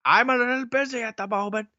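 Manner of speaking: high, prim put-on voice; airy, breezy fake politeness; high mock voice. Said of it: "mock reply in high voice".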